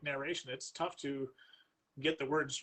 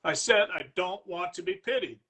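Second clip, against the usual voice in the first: slow, louder, insistent protest; raised voice; 3 semitones higher, 6 dB louder than usual. Acted. Man cutting off his companion's lecture, slowly raised voice. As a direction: push back hard, each word weighed. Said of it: "raising his voice".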